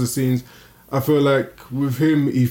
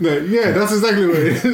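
higher, laughing through the words; laughing agreement to friend's joke; 7 semitones higher than usual. Real, not acted. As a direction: agree while cracking up.

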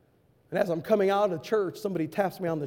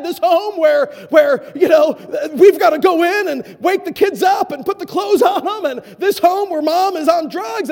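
loud, high, sarcastic God bless this home; indignant preaching shout; 11 semitones higher, 10 dB louder than usual. Retold or imitated. Preacher raging at the door's words, loud and high. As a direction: build to a furious shout. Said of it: "I thought to myself".

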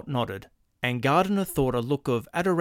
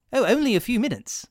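much higher, eager salesman; 10 semitones higher, faster than usual. Acted. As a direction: jump at the chance. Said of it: "the clerk said eagerly".

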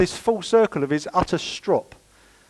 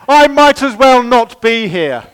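higher, louder, slow sulky whine; mock sulk; 7 semitones higher, 10 dB louder, slower than usual. Someone in a strop announcing their exit, slow sulky whine. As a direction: drag it out so everyone hears you sulk. Mock sulker making sure others know.

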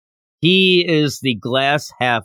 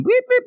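much higher chirpy beep beep; bird chirps. Retold or imitated. Host imitates the bird's call, high chirping. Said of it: "This is the chirping talk".

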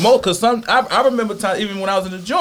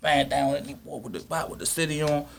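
hushed fan quote; 3 semitones lower, 10 dB quieter than usual. Retold or imitated. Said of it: "he gonna throw that same shit on".

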